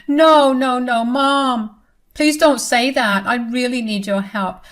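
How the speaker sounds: a daughter begging her mother for help. A narrator's reading. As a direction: plead with Mom not to abandon you. Audrey begs for help.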